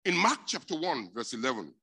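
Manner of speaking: high, loud scripture call; loud announcement. Real, not acted. Preacher announcing the verse to the church, loud.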